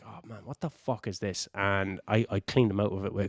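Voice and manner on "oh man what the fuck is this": much softer, stunned under the breath; hushed shock. Retold or imitated. Wrestler taking stiff forearms in the face, hushed disbelief. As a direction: mutter it as the blows land. Getting hit hard in the face.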